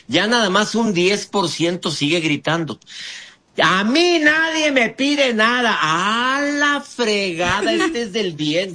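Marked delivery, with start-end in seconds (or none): 3.6-5.8 stubborn shouter. Acted. Role-playing stubborn shouter. 5.8-8.7 high, dragged-out fed-up sigh of words; a mock-exasperated aside; exasperated groan.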